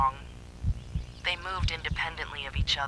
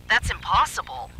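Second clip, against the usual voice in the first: high, louder, shocked denial; startled disbelief; 6 semitones higher, 5 dB louder than usual. A narrator's reading. Ben staring at the vanished door, shocked. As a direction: refuse to believe it, voice jumping up. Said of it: "Ben said".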